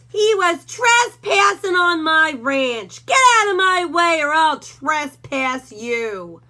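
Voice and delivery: a bully dog snarling at Hank; menacing Doberman. A narrator's reading. Rufus voice.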